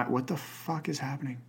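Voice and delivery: under your breath, in disbelief; shocked aside. Real, not acted. Shocked aside while reading question.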